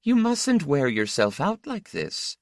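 high-pitched motherly reproach; murmuring mother, only lightly hushed; mother's murmur. A narrator's reading. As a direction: gently chide in a high voice. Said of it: "she murmured".

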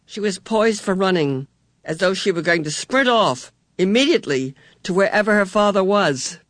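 plain reading voice

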